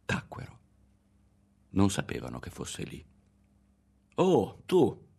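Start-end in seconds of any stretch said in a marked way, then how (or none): 4.2-5.1 high, loud greeting; bright burst of recognition; exclaiming. A narrator's reading. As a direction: exclaim on spotting someone you know. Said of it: "esclamò il campione".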